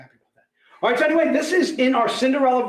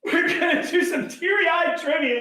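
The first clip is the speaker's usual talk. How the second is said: excited shouting; 5 semitones higher than usual. Real, not acted. Host hyping the chat about trivia, laughing shout. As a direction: whip the stream into a laughing frenzy.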